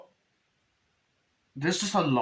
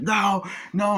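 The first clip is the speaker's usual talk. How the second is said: panicked no; 10 semitones higher, 4 dB louder than usual. Retold or imitated. Fights the scarecrow.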